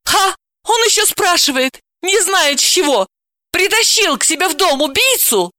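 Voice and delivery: very high, mocking outburst; outraged, pitch way up; scornful indignation. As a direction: throw it at him, incredulous. Quarrel, scornful indignant retort.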